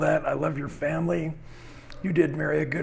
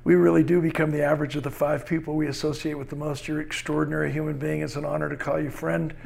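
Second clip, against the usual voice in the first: ordinary talk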